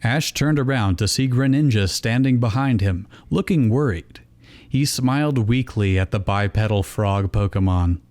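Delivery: ordinary delivery, nothing marked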